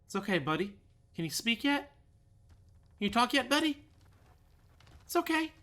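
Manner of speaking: very high pet-talk voice; cooing reassurance. Pretend. Coos to his injured game character.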